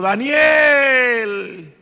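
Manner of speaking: anguished cry. Retold or imitated. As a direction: cry the name out across the den. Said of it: "con grandes voces y con una voz muy triste". (grito angustiado del rey: ¡Daniel!)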